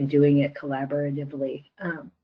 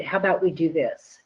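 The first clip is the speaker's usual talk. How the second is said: ordinary talk, nothing marked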